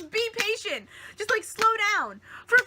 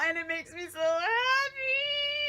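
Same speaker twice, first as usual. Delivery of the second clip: gushing; 7 semitones higher than usual. Real, not acted. Overjoyed fan gushing.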